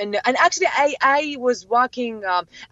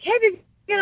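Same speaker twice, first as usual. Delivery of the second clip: high, bright retold hello; excited greeting; 10 semitones higher than usual. A fan spotting Vivian, eager high greeting.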